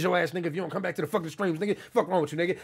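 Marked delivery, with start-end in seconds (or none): none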